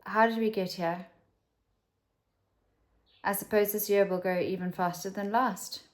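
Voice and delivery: usual speaking voice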